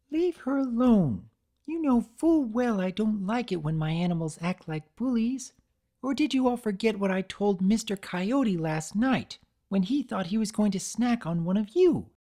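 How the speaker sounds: high firm scolding. A narrator's reading. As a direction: tell off misbehaving pets. Fluttershy scolding possums.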